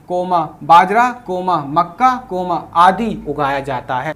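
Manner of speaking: slow comma-by-comma reading. Shown as a demonstration. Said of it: "तो coma कराया".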